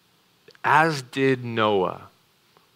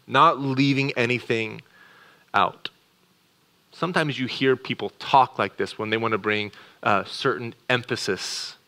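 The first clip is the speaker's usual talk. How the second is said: normal talking voice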